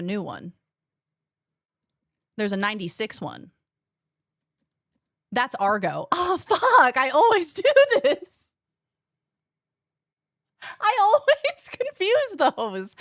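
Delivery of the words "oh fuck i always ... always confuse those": laughing through the words, real amusement; breaking into laughs at her own mix-up. Real, not acted. Laughing at mixing up Argo and Fargo.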